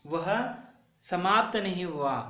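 ordinary talk

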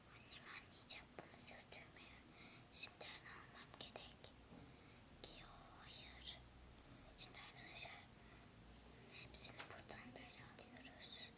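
soft breathy whisper to the viewers; ASMR whisper. Acted. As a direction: whisper it all like an ASMR video. Whispered ASMR-style talk to viewers. (fısıltıyla ASMR anlatımı)

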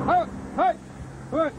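shouting hey. Real, not acted. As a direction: call out to stop them. Man shouting at camera crew.

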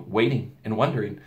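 plain reading voice

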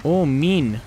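ordinary talk, nothing marked

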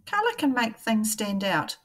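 ordinary talk

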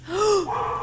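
soft high gasp of fright; frightened gasp. Real, not acted. Player frightened by the horror game, soft high gasp. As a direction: gasp in sudden fear.

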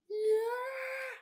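high, loud yeah; excited yell. Real, not acted. Kid recapping the movie cheers the snap, excited yell.

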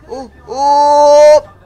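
alarmed. Real, not acted. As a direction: stretch the oh in rising alarm. Rhinoceros charging in game. (oh prolungati, allarmati)

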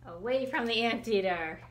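ordinary delivery, nothing marked

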